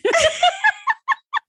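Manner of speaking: high burst of laughter; sudden high-pitched laugh; big laugh. Real, not acted. Bursts out laughing at book joke.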